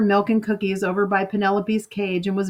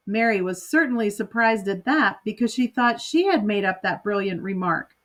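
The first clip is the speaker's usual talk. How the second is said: ordinary delivery, nothing marked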